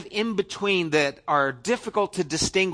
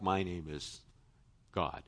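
flat mock introduction; 11 semitones lower, 14 dB quieter than usual. Mock bland self-introduction of God.

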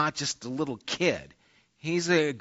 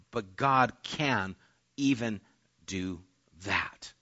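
hushed awe; 7 semitones lower, 7 dB quieter, slower than usual. Acted. Abraham's faith, fading to whisper.

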